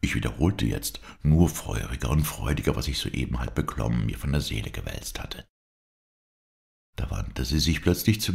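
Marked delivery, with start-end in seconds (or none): none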